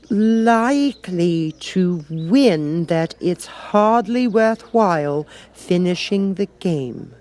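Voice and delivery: put-on a young girl smoothing over her words; Alice covering. Alice covers herself as the Queen listens, slow and girlish.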